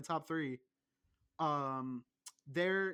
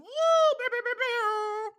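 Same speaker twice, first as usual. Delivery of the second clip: high, loud robotic beeping; squeaky mock buzzer beeps; mock alarm beeps; 10 dB louder, much slower than usual. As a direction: beep like a shrill alarm going off. Mock alarm after "Woo!.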